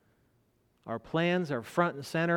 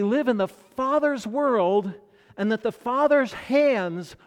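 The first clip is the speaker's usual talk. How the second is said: higher, louder fervent sermon voice; preaching at full force; 6 semitones higher, 6 dB louder than usual. Acted. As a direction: drive the truth home to the congregation.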